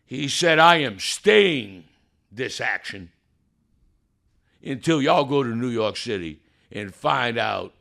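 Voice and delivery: loud, high, drawn-out proclamation; triumphant preacher's cry, fading at the end; preaching at full force. Acted. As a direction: shout the victory to the congregation. Preaching the judge's ruling.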